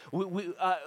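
normal talking voice

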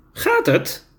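a tiny creature asking kindly; small creature voice. Acted.

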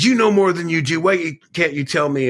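high, pleading demand for answers; lightly voiced frantic questioning; desperate pleading. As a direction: press him, close to breaking. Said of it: "I was getting a little unstable".

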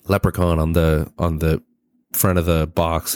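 normal talking voice

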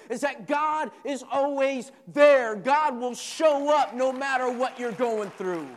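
high, loud, rising sermon cadence; a pastor building to a loud, high call over the church; preaching at full force. Acted. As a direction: preach it to the congregation with fire.

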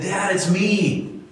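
put-on child's call. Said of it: "And his son screamed back".

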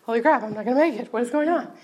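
fast, loud, rising alarm; rushed imitation of being overwhelmed; mock panic. Speaker mimics rock stars losing their composure, quick mock panic.

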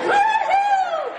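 very high, loud whoop; joyful whoop. Retold or imitated. An overjoyed king whooping as he dances around. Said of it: "and started, dancing around".

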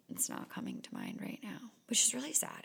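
voiceless mumbled aside; whispered aside. Real, not acted. Failing to remember a film, whispered aside. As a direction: drop to a whisper to herself. Can't recall a movie.

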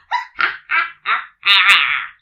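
loud, drawn-out witch cackle; wicked staccato laugh ending in a long cackle; evil cackle. A narrator's reading. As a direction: cackle loudly like a storybook witch. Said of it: "an evil cackle".

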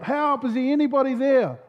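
high-pitched acted call for help; crying out. Hiker on a branch calls for anyone, acted cry. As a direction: call into the distance, mildly desperate. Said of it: "He cries out".

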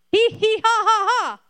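high, loud burst of laughter; laughing. Real, not acted. Preacher laughing at his own joke to the congregation, high and loud.

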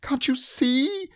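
very high, slow strained squeak; a frustrated piglet; shout. Acted. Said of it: "shouted Piglet".

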